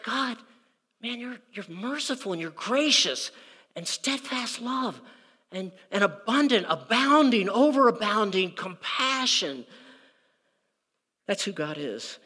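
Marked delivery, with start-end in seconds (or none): 0.0-9.6 David in hushed wonder at God's mercy; voicing David. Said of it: "he looks in the face of God and he says".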